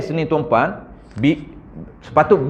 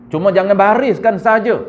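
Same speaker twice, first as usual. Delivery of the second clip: ordinary talk, nothing marked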